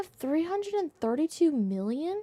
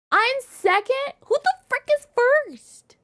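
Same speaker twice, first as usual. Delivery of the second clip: high, loud and slow, stunned disbelief; shocked outrage; 13 semitones higher, 8 dB louder, slower than usual. Real, not acted. Streamer sees she's second on the leaderboard, loud shock. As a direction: stretch it out, stunned.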